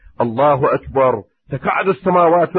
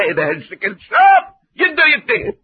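emphatic preaching; 13 semitones higher, 4 dB louder than usual. Acted.

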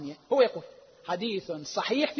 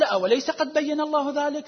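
higher, rising wonder on الله; stronger astonishment; 7 semitones higher, 3 dB louder than usual. Retold or imitated. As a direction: really marvel that he didn't know. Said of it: "عبارة تعجب من النبي". (تعجب واضح بنبرة أعلى في "الله")

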